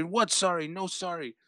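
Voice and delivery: high-pitched, indignant broken English; imitated angry yelling. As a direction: reject the apology in mock outrage.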